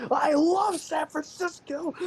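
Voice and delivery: high, loud, slow yelled shout; retold scream out window. Tired friend yelling out the window at the city, loud and high. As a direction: belt it out the car window, stretched and giddy. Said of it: "screaming at the air".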